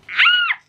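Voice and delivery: very high loud squeal; delighted scream; excited squeal. Real, not acted. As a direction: let out a real shriek of joy. Squeal over celebrity reply.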